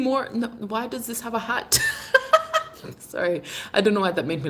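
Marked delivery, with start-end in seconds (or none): 1.8-2.9 sudden high laugh. Real, not acted. Laughs at a hat.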